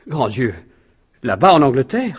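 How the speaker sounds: a butler stunned by news of the murder; shocked. Acted. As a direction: gasp it out in disbelief.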